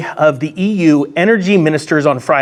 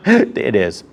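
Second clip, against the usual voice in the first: laughing it out; 4 semitones higher than usual. Real, not acted. Vlogger laughing at his own joke, laughing. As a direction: chuckle through the words.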